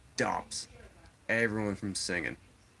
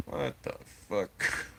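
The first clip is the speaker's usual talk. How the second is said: retold disbelief; 3 semitones higher, slower than usual. Retold stunned reaction.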